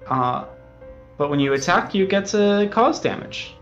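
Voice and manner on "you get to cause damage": ordinary talk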